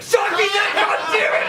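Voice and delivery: startled, high-pitched cry of pain; words jump high as the jolt hits; real shock yelp. Real, not acted. Comic shocked by a collar mid-sentence, yelping high.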